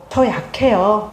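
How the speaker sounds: slowly admitting weakness; slow, gentle confession. Shown as a demonstration. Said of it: "말해보세요".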